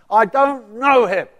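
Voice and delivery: slow loud furious denial; angry denial. Acted. Peter swears he doesn't know him, slow furious shout. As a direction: drag it out, shouting.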